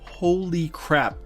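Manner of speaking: usual speaking voice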